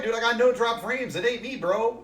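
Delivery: high, loud indignant denial; loud protest. Real, not acted. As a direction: shout it's not your fault. Denies his internet is lagging.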